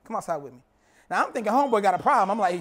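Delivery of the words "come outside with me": low, soft and hushed; quiet. Retold or imitated. Friend calling him outside at the party, hushed. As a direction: hush it like a secret. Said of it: "he comes up to me real quiet".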